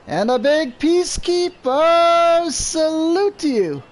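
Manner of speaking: high, slow, booming salute; crazy voice. Pretend. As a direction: stretch out the salute grandly. Greets viewer with his catchphrase.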